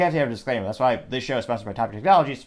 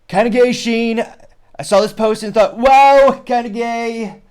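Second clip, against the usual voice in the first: much higher and louder, hammed-up Trump voice; Trump impression; 10 semitones higher, 10 dB louder, slower than usual. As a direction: go big and loud with a cheesy Trump take. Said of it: "I will attempt to do the Trump impression".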